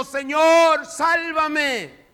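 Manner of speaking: loud, high desperate cry; cry for help. Acted. Peter sinking in the waves, loud desperate cry. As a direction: shout it while sinking. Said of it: "Dio voces entonces diciendo".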